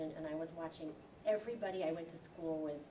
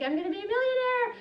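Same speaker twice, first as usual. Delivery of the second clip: much higher, much louder gleeful shout; greedy glee; 14 dB louder than usual. Retold or imitated. The man dreams of riches from his frog, shouting gleefully. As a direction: crow it like you've struck gold. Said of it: "He's like".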